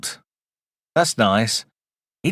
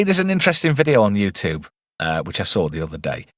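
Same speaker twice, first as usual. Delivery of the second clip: normal talking voice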